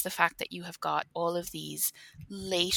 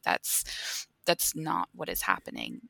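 ordinary talk

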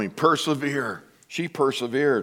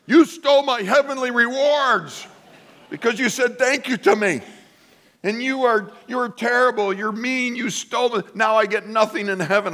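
high-pitched accusing church member; hammed-up indignant complainer; outraged caller; 10 semitones higher than usual. Caller accusing pastor, retold.

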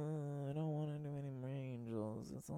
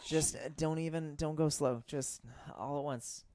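flirty; 4 semitones higher, 7 dB louder than usual. Acted. Rise Beth keeps teasing.

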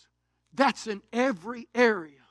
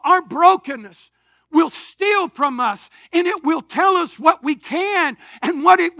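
raised, driving preacher voice; fervent preaching; 5 semitones higher, 7 dB louder than usual. Acted. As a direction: preach it out to the congregation. Preaches at full force.

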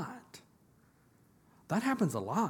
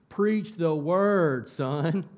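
much louder and higher, stretched out very slowly; emphatic command; 5 semitones higher, 8 dB louder, much slower than usual. Retold or imitated. Paul gripping Timothy's shirt, urgent loud slow command. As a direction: seize him by the collar and drive "Preach the word" home. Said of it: "grabbed Timothy's shirt and brought him up".

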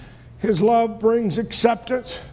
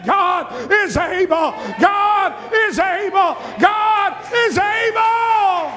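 each God is able slower and higher, shouted; rising refrain; 9 semitones higher, slower than usual. Acted. Preacher declaring faith over the odds, shouted climbing refrain.

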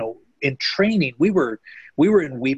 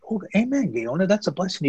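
a touch of bright, much higher delight; delighted reply; 8 semitones higher than usual. Retold or imitated. Missionary retelling his joyful answer to the convert, lightly excited. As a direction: pitch jumps up in happy surprise, retold.

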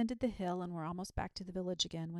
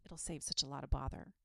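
plain reading voice

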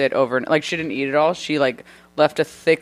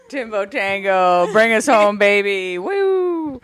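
cheering; 5 semitones higher, slower than usual. Pretend. Cheering Tim on.

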